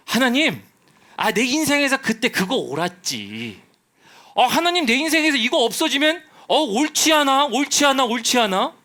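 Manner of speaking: protesting to God. Acted. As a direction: plead your case against God loudly. (하나님께 높고 큰 소리로 항의)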